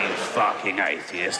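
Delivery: Satan sneering. Retold or imitated. Satan taunting the atheist in hell, low slow sneer. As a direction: growl the insult down low like Satan. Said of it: "So he's like".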